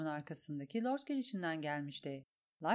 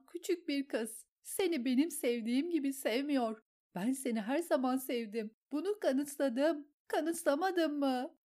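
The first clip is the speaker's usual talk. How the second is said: hysterical jealousy; 6 semitones higher than usual. A narrator's reading. Lysa begs Petyr in jealous hysteria. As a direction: beg him to love her.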